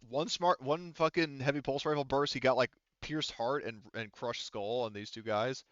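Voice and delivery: usual speaking voice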